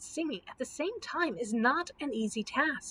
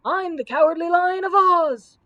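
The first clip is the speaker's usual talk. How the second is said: high, loud roaring boast; 6 semitones higher, 9 dB louder than usual. A narrator's reading. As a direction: roar out your name to scare them. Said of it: "roared the lion".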